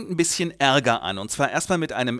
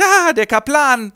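loud, much higher, slow alarmed yelp; panicked cry; 11 semitones higher, 9 dB louder, slower than usual. Retold or imitated. Grandpa hiding from the chaplain, loud panicked yelp. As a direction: cry out and dive for cover. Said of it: "springt Opa unter den Tisch".

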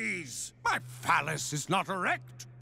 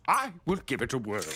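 normal talking voice

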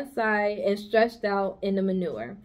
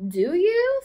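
affectionate Charlotte; 10 semitones higher, 4 dB louder than usual. Acted. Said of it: "looking at him with affection".

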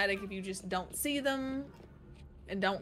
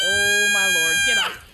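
loud panicked shout; yelling; 4 semitones lower, 11 dB louder than usual. Real, not acted. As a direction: yell it out at the monster. Enemy grabs teammate, yelling.

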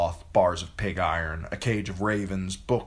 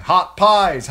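much higher, loud vendor's call; street cry; 13 semitones higher, 12 dB louder than usual. A narrator's reading.

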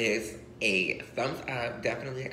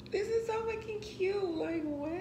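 much higher squealing disbelief; excited squeal. Real, not acted. Squealing to viewers over the last silver piece. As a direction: squeal like you can't believe it.

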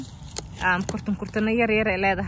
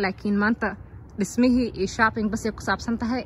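normal talking voice

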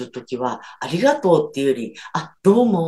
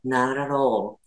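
American "Not at all" as "naralo", soft flapped t's, a bit softer; American accent; 4 semitones lower, 4 dB quieter, much slower than usual. Shown as a demonstration. Teacher contrasting American and British English for learners, American accent. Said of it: "アメリカ人だと".

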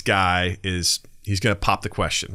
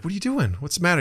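voiced girlfriend; 8 semitones higher, faster than usual.

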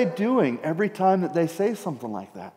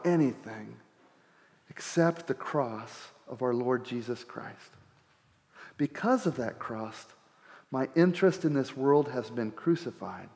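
scripture reading; 4 semitones lower, 6 dB quieter than usual. Acted. Pastor reading Paul's words to the congregation, soft and reverent. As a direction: read the verse quietly and reverently.

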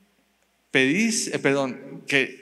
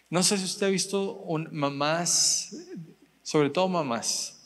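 ordinary talk, nothing marked

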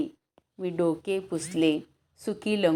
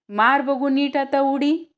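urging call; 7 semitones higher, 6 dB louder than usual. Acted. Balu urges boy to jump.

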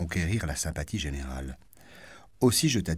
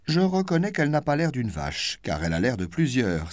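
high, louder triumphant exclamation; exclaiming; 8 semitones higher, 5 dB louder than usual. Acted. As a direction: cap his line with a punch. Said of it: "s'écria madame de Guermantes".

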